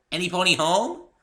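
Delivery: high, loud bright call to the house. A narrator's reading. Pinkie calling at the library door, loud and high. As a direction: call out for Twilight and Spike. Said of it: "she called".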